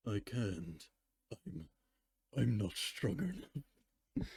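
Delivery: hushed and slow, like giving up; despairing confession. Acted. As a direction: whisper out a weak, defeated admission. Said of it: "memes have ruined that line".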